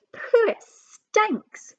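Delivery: high, slow squeaky disgust; disgusted thief. Acted. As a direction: pinch your nose and whine it out slowly. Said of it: "and Len said".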